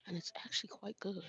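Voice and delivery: whispered appreciation of the tea; hushed, pleased aside about the drink. Real, not acted. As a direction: drop to a whisper, savouring the taste. Aside while tasting tea.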